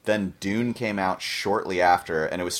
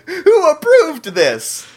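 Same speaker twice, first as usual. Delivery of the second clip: slow, high, louder question through laughter; laughing mock outrage; 7 dB louder, much slower than usual. Co-host laughing at an old photo, mock outraged. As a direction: laugh out the disbelief.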